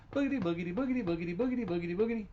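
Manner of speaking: high, bouncy bike-riding chant, sing-song; bouncy boogity chant. A narrator's reading. Storyteller chanting the bike ride to kids, high and bouncy. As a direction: chant it like pedalling fast.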